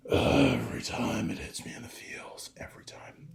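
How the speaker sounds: fighting back real tears; words fading out as the tears come; whispered through tears. Real, not acted. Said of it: "I'm gonna tear up during this".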